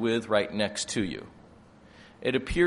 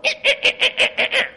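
high, laughing eh-eh-eh buzz; mock buzzing highlighter noise; 8 dB louder, faster than usual. Preacher mimicking God highlighting the command, high laughing buzz. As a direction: make a silly highlighter sound. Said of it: "and go".

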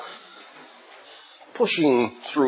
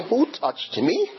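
slow, higher, incredulous echo of Who touched me; mocking echo; 8 semitones higher, slower than usual. Disciples echoing Jesus in disbelief, slow mocking rise. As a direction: disciples mock the question with a rising tune. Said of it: "Peter and the other disciples".

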